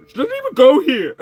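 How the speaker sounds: high, loud, fast mock outrage; hammed-up movie quote. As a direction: belt the famous line for laughs.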